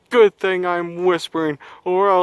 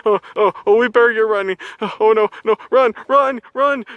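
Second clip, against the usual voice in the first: being chased; 8 semitones higher than usual. Acted. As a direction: yell it while fleeing in panic. Fleeing the monster.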